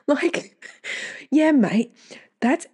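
real derisive laugh at him; laughing sarcasm. Real, not acted. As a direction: scoff and laugh it off.

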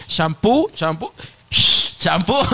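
slow stretched shampoo chant; lazy bath chant; drawn-out sing-song. Pretend. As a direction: drag out each shampoo. Acting out bathing.

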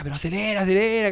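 lightly acted high-pitched urging; imitated nagging yell. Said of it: "y gritaba".

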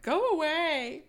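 high, loud, drawn-out call; mock shout. Sydney to the men banging on the door, mock shout. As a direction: holler it slowly through a door.

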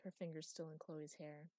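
ordinary delivery

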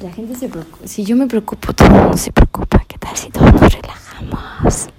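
fast whisper. Real, not acted. Musing that everyone worries, whispered. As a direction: breathe it out in a rush.

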